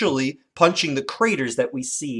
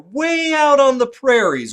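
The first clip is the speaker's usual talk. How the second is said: much higher and louder, sweeping tall-tale voice; storyteller opening; 10 semitones higher, 6 dB louder than usual. Shown as a demonstration. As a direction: paint the wide prairie with your voice. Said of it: "start with something like".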